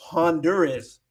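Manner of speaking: Honduras said han-DUR-us with sounded H; English pronunciation. Shown as a demonstration. Teacher shows the English name, Honduras as han-DUR-us. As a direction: say Honduras the American way, H voiced. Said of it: "Así es como se dice en, en inglés".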